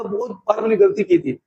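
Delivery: usual speaking voice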